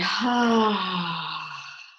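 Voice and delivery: big, loud, open sigh out; letting-go sigh. Shown as a demonstration. Yoga teacher showing how to let the breath out, sighing. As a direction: let the breath go in a long audible "haah". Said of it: "letting it out in a sigh".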